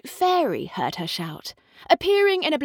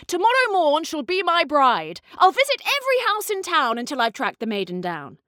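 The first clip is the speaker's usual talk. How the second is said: higher, grand announcing voice; proclaiming prince; 6 semitones higher than usual. A narrator's reading. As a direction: declare it like a royal decree. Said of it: "he cried".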